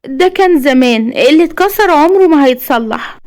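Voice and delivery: put-on high, wistful young woman's voice; sad smile. A narrator's reading. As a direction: speak through a sad smile, pitched high. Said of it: "جيهان بابتسامة حزينة". (بابتسامة حزينة)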